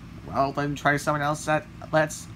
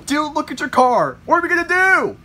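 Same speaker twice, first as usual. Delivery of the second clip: loud, much higher, frantic cry; panicked yell; 10 semitones higher, 6 dB louder than usual. Acted. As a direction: yell in alarm at the wreck. Panic at wrecked car.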